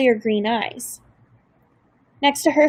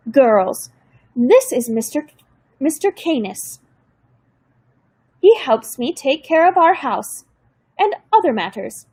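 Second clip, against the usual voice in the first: gentle grandmother with a hint of German accent; 4 semitones higher than usual. A narrator's reading. Grandmother introduces Mister Canis.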